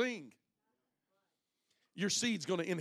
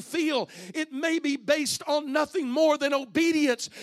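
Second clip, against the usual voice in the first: rising, louder and louder preacher's climb; a preacher climbing toward a loud, high climax; building preaching; 6 semitones higher, 10 dB louder than usual. Acted. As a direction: build it to a shout, phrase by phrase.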